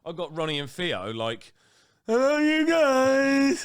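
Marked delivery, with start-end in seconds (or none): 2.1-3.6 put-on squeaky high drawn-out kid greeting; hammed-up child voice; mock kid voice. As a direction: mimic a little boy saying hello. Father mimics boys greeting.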